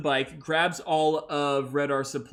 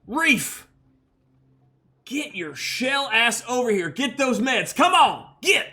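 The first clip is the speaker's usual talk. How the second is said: urgent barked orders; 9 semitones higher, 4 dB louder than usual. Acted. Red R orders Reef urgently.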